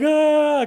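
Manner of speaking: a loud, high wail, imitating a kid who bursts into tears; mock crying wail. Dad imitates his kids breaking down after his lecture, a loud mock wail. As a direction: give a short retold kid's cry of "Ah".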